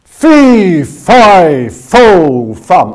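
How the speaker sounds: big bellowing giant shout, loud and high; terrifying giant. Shown as a demonstration. Coach showing the class a terrifying giant bellow. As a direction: boom it out like a ten-foot giant. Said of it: "give me the biggest bellyish".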